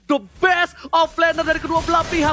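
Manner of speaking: rising hype play-by-play; fighting game announcer. Acted. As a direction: call it like a big fight. Hyped esports casting.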